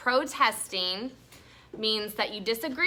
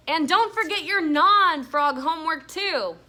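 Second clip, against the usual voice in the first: louder call up the stairs, higher; 6 semitones higher, 6 dB louder than usual. A narrator's reading. Mom calling up the stairs after Stink, louder. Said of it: "Mom called".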